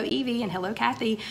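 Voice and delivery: ordinary talk, nothing marked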